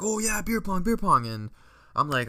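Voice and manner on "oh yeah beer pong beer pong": high-pitched mock crowd enthusiasm; excited party cheer. Everybody at the party suddenly wants a game, excited chant. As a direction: call it out like a rowdy partygoer.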